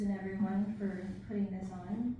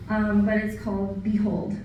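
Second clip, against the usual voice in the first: usual speaking voice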